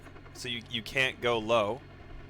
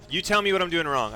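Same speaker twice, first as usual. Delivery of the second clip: louder, higher, pleading at the audience; exasperated outburst; 6 semitones higher, 7 dB louder than usual. Real, not acted. Gamer venting to his viewers after another abort, exasperated. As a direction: throw the question at the viewers in frustration.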